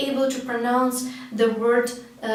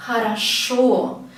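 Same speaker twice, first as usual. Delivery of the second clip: "хорошо" as kha-ra-SHO, slow with a long final O; stress on last syllable; slower than usual. Shown as a demonstration. Teacher pointing out the stress, "хорошо" stressed on final O. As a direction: lean on the "шо" of "хорошо". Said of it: "stress here goes to the last syllable".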